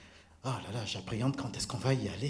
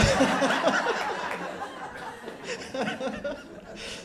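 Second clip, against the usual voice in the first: high, breathy, long laughing fit; long giggle; 13 semitones higher than usual. Real, not acted. Recalling calling Denis away from his poutine, giggling. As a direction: laugh helplessly at the memory.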